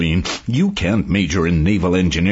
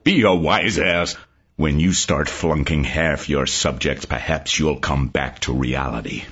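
lower, clipped-off fuming retort; barely held anger; 10 semitones lower than usual. Retold or imitated. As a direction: hold back the explosion. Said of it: "Tokas almost exploded".

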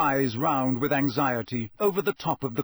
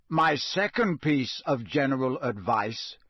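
ordinary delivery

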